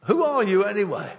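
loud, high-pitched confrontation; challenging demand. Acted. Sailors demanding Jonah explain himself.

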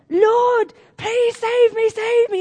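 high, loud pleading cry; desperate cry for help. Retold or imitated. Man hanging off a cliff in the storm begs the Lord, high desperate cry. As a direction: shout up to the Lord, clinging on. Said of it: "and he's saying".

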